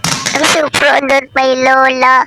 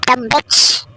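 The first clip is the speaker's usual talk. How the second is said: usual speaking voice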